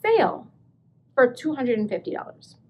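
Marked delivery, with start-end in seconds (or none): 0.0-0.5 sale pitched high and falling; stress on sale. Shown as a demonstration. Thrilled it is on sale. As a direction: lean hard on sale. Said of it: "it's on sale".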